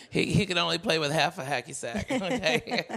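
laughing through the punchline. Real, not acted. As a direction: chuckle while finishing the joke. Laughs at own joke.

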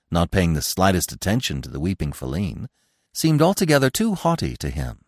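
ordinary delivery, nothing marked

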